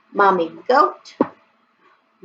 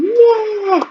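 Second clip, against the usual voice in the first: cheer; 12 semitones higher than usual. Acted. Cheering the class.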